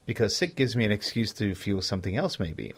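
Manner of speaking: ordinary talk, nothing marked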